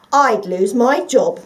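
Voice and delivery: slow, loud indignant; offended at the very idea. Acted. The dog refusing the fox, slow indignant. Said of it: "said the dog indignantly".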